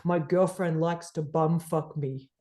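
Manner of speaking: low, slow deadpan caller voice; mimicking the caller, low and drawn out; deep voice. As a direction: drop low and slow for the punchline. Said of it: "I hear this deep voice say".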